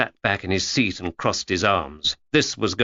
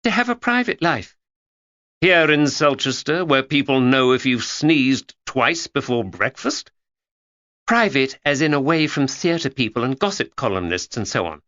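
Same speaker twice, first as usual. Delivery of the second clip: put-on young Georgia; 5 semitones higher than usual. A narrator's reading.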